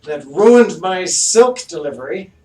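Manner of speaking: high, loud haughty noble voice; pompous mayor. Acted. Mayor accusing the party of ruining his silk, haughty and loud. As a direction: look down your nose at them. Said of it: "And he's like".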